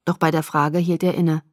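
plain reading voice

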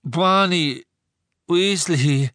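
high, loud, slurred words; mouth full of blood. A narrator's reading. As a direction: force the name out through blood. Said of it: "dass sein Mund voller Blut war".

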